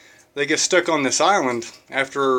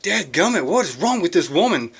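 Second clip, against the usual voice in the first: mock exasperation, pitched up; irritated; 4 semitones higher than usual. Jogger fed up with the crying woman, irritated. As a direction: gripe in mild comic irritation. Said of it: "So he's so irritated".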